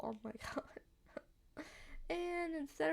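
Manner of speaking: slow whispered giggle; laughing whisper. Real, not acted. Cracks up at own line.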